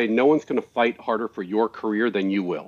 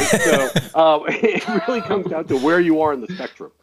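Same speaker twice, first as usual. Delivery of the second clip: laughing; 4 semitones higher than usual. Real, not acted. Laughs over his own point.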